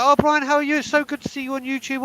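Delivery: high, gushing mock-sweet voice; fake friendly greeting. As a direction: sends up a fair-weather friend's fake delight. Mocking friends who ditched him.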